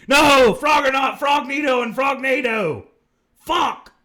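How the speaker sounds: loud, high-pitched comic wail; mock horrified shout. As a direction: yell it in hammed-up dismay. Mock horror at the invented monsters.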